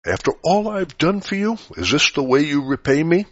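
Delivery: shrieking in indignant reproach; screamed reproach. Retold or imitated. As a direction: throw it back as a wounded partner screaming. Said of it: "he or she will scream".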